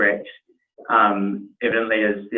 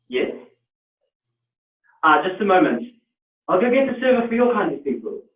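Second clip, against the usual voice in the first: higher, polished South African waiter voice; 6 semitones higher than usual. Acted. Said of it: "that was a, a very good accent".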